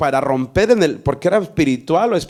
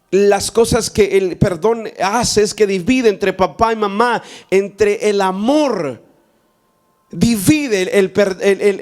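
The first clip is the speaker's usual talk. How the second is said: fervent preaching; 4 semitones higher than usual. Acted. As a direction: preach it to the congregation with fire. Preacher rises to full sermon. (predicador fervoroso)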